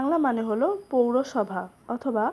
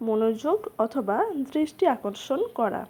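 normal talking voice

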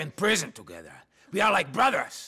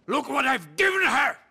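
loud, raised indignant rant; angry outburst; 7 semitones higher, 7 dB louder than usual. Acted. Father ranting to Niko about his ungrateful daughter, shouting. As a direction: throw the words out in outrage.

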